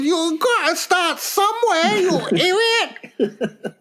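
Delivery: very high mock Chinese accent, last word stretched; Ray, mock Chinese accent. Quoting his mate telling him off, high slow mock Chinese accent. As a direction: imitate a scolding Chinese mate, squeaked up high. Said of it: "He goes".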